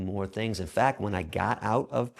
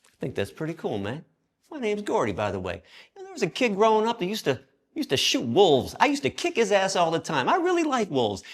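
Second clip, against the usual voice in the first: big inmate voice; 10 semitones higher, 4 dB louder than usual. Retold or imitated. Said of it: "He looks at me and goes".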